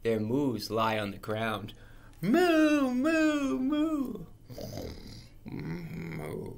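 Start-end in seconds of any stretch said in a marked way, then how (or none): 2.2-4.3 high moos rising and falling; bouncing moos. Acted. Reader acts cows on a trampoline, high bouncing moos. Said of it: "their moos go up and down". 5.5-6.5 low, very soft, drowsy moo; sleeping moo. Acted. Reader acts a sleeping cow, sleepy moo. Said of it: "when they go to sleep".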